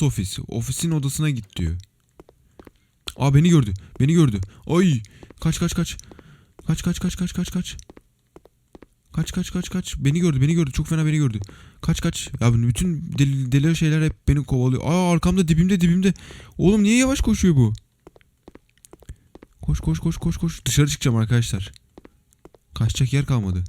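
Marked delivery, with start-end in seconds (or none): 3.2-12.3 high, hushed panicked urging; frantic run-run under breath; being chased. Real, not acted. Enemy spots him, he flees. 14.8-20.6 high panicked breathless urging; frantic run chant, boss at heels; being chased. Real, not acted. Boss right behind, running.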